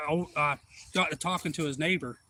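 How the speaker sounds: plain reading voice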